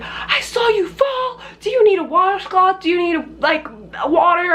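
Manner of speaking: high-pitched imitation of a worried man; alarmed neighbor. Alarmed man runs out to help.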